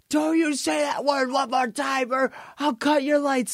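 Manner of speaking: high-pitched mock shout; Vince McMahon threat. Wrestling boss threatens the heckling crowd, high mock shout. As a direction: imitate an exasperated boss warning a crowd.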